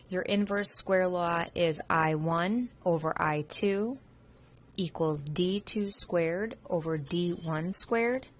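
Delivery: slow and deliberate, each symbol set apart; slow formula dictation. Real, not acted. Teacher reading the inverse square law to students, slow and deliberate. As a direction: read the equation so students can write it down.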